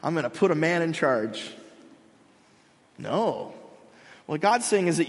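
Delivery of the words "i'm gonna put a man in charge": mocking gloating God. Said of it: "God's not saying".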